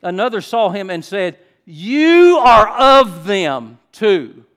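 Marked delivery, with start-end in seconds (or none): 1.6-4.4 "You are of them too" very loud, high and drawn out; punchy emphatic accusation. Shown as a demonstration. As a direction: hammer "You are of them", slowly and loudly. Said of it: "it really draws a punch".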